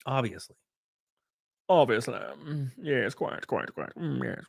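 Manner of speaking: soft, put-on pompous murmur; hushed mock-refined voice; mock snooty agreement. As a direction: play a self-satisfied toff agreeing.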